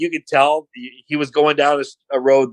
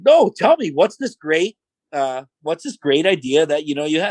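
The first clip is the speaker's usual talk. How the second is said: sarcastic goading; 3 semitones higher than usual. Retold or imitated. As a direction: press him with fake interest. Boss goads nervous wrestler.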